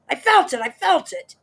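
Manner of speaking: high, loud panicky yelling; frantic shout. Acted. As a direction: yell it over and over in alarm. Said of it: "shouted Mister Twit".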